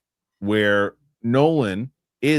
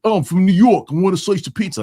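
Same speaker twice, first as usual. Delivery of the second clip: loud, high, hammed-up New York accent; imitating a bad New Yorker, pitched up and loud; 8 semitones higher, 5 dB louder than usual. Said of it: "somebody's doing a bad accent".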